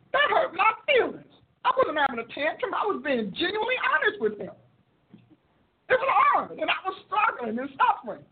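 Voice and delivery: hurt whine. Retold or imitated. Wounded complaint retold.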